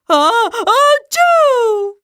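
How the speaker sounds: slow high ah-choo; big drawn-out sneeze; loud sneeze. Acted. Sneezes again.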